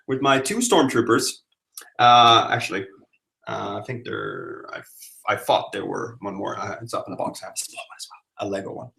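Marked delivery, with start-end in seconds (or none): 3.5-8.9 soft muttered aside; half to herself, very soft; quiet aside. Real, not acted. Mumbling aside while looking.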